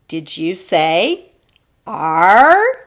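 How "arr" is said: loud, long pirate "Arr" swooping upward. Acted. Teacher checks the learners' answer, questioning pirate "Arr". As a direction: ask it as a stretched pirate "Arr". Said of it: "like a pirate".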